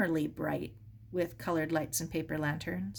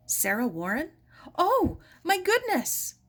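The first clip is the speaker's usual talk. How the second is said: high, loud astonished cry; surprised exclamation; 10 semitones higher, 6 dB louder than usual. Acted. Helen astonished to find Sarah at her door. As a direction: gasp it out in astonishment.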